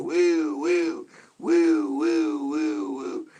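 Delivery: high loud slow siren woo; steady siren wail. Retold or imitated. Showing how police should run sirens, steady wail. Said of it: "flick it on and go".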